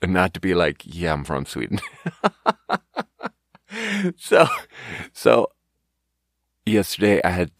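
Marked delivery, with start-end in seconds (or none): 0.8-1.8 low, soft, retold reply; muted admission. Waiter answering whether he has been to Sweden, muted. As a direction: drop your voice as you own up. Acted reluctant answer to customers.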